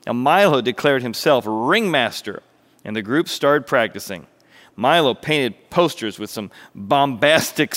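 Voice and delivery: animated storyteller, projected and expressive; story narration. A narrator's reading. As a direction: tell it like a lively children's tale. Narrates a parable.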